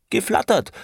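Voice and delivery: very high, emphatic outburst; indignant insistence. A narrator's reading. Franz insists on his word to Aneschka, indignantly.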